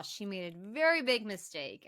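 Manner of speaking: normal talking voice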